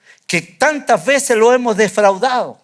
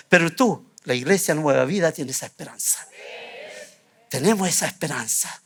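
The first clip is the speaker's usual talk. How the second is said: softer, intimate reassurance to the flock; tender appeal; 6 semitones lower, 7 dB quieter than usual. Acted. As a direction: lower and softer, comfort each listener. Tender closing appeal to congregation.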